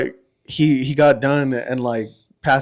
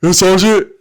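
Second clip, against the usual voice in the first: high, loud simple-soldier impression; Chavez; 7 semitones higher, 8 dB louder than usual. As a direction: imitate an eager, dim private reporting in. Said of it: "that's how he talked".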